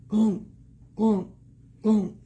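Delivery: loud, separated stomps; heavy elephant steps. Acted. Reader shows child how an elephant walks, loud heavy stomps. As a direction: thump each word out like a huge footfall.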